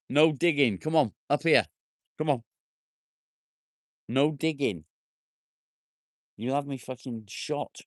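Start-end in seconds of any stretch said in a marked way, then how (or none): 0.1-4.8 commanding a dog. Real, not acted. Owner stopping his dog digging, firm command. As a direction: tell the dog off sharply.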